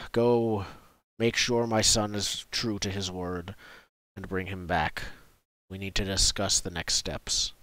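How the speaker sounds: slow, low, measured warning; stern village chief, scornful yet respectful. Acted. Chief cautioning guests then sending them after his son, sternly.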